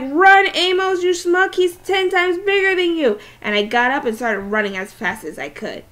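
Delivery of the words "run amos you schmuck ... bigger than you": imitated yell. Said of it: "I was like".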